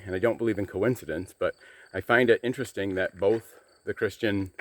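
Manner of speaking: ordinary delivery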